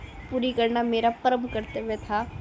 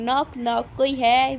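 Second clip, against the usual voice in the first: playful knock-knock call, louder on the question; 6 dB louder, slower than usual. Acted. Mother at the kids' tent asking who's there, playfully calling out. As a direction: call out as if at a door. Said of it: "अब मैं कर रही हूँ".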